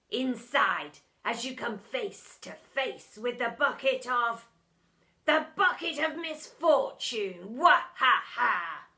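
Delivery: slow, loud villain gloat with laugh. A narrator's reading. Teacher gloating over his trap, hammed-up. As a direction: ham up a mad teacher's triumph.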